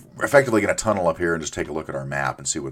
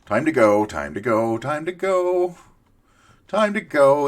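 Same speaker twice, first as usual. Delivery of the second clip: being chased; 9 semitones higher, 4 dB louder than usual. Real, not acted. Fleeing a mob.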